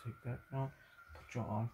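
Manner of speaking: ordinary talk, nothing marked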